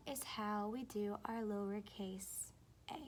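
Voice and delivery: soft confiding whisper; hushed classroom whisper. Shown as a demonstration. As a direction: whisper it like a secret.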